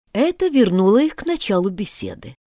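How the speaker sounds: ordinary delivery, nothing marked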